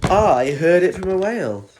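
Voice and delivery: lightly voiced big whale. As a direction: boom it out as the whale. Voicing the whale.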